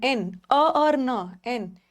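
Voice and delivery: एन as e then n, very slowly; slow spelling out. Shown as a demonstration. Teacher splitting एन into sounds for students. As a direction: drag out each sound of एन. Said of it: "और यहाँ एन".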